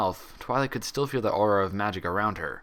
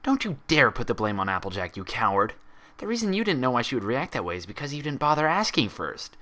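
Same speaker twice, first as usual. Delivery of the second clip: angry accusation; 8 semitones higher than usual. A narrator's reading. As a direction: tear into her cowardly reflection. Twilight berates her reflection.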